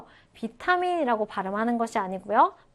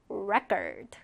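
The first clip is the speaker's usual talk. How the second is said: "record" said REC-ord, falling after the first syllable; stress on first syllable; 3 semitones lower, 6 dB quieter than usual. Shown as a demonstration. Teacher repeats the noun form, first syllable of "record" stressed. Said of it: "자, 따라해볼까요?".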